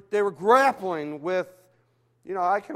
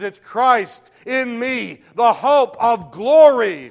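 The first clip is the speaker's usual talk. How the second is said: higher, much louder, slow proclamation; preaching climax; 5 semitones higher, 13 dB louder, much slower than usual. Acted. Preacher voicing Paul to the church, loud slow proclamation. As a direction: proclaim it at full force.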